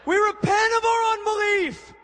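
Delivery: slow, high roaring commands like a centurion; gruff barked parade orders. Acted. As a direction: bark each line like a soldier. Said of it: "a little centurion moment".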